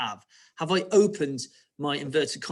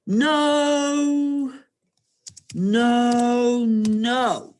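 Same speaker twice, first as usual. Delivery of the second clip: high, loud, drawn-out piggy cries; stretched high-pitched protest; piggy's refusal; 9 semitones higher, 7 dB louder, much slower than usual. Acted. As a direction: wail each word loud and long. Pig's refusal, dictated loudly while writing.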